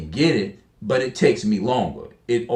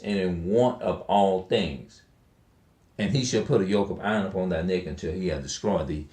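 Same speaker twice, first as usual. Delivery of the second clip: scripture reading; 4 semitones lower, 4 dB quieter than usual. Acted. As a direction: read the verse like holy text, measured. Reads Deuteronomy aloud.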